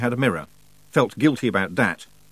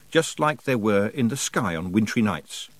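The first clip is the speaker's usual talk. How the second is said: ordinary delivery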